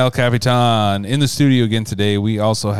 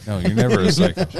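laughing; 10 semitones higher, 3 dB louder than usual. Real, not acted. Laughing mock reassurance.

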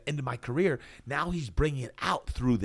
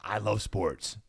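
ordinary delivery

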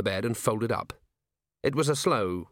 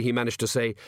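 usual speaking voice

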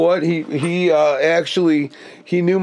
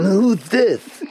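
higher, slow mock Tom voice; imitating Tom; 6 semitones higher, slower than usual. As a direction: throw the question back in Tom's voice. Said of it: "imitating his voice so perfectly".

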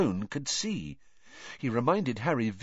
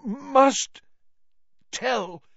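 high, strained, one word at a time; agonised, halting gasps; terrible effort; 13 semitones higher, 7 dB louder, much slower than usual. A narrator's reading. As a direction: force each word out with long gaps. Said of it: "Every word he spoke seemed to cost him a terrible effort".

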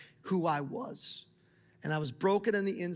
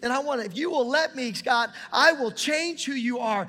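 much higher and louder, near shouting; full-force preaching; 8 semitones higher, 9 dB louder than usual. Acted. Pastor telling the church God is still changing him, loud fervent preaching. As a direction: let the sermon climax loudly.

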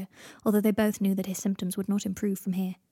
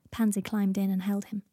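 usual speaking voice

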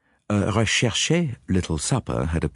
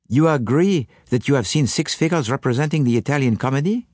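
put-on higher-pitched French-accented detective; Poirot questioning; 6 semitones higher than usual. Detective checks what the witness saw, questioning. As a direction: seek agreement in a French accent, ending upward.

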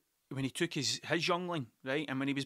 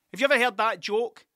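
louder and higher, eager to launch a joke; excited pub-joke opener; 6 semitones higher, 8 dB louder than usual. Retold or imitated. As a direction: lean in like you're about to tell a great joke. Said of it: "And then I stopped".

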